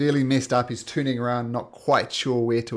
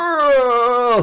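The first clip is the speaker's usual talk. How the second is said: loud, high drawn-out "oh"; hammed-up dying wail; acted death cry; 11 semitones higher, 11 dB louder than usual. Game master as the giant cut in two, loud dying cry.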